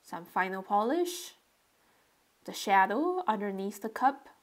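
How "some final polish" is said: ordinary delivery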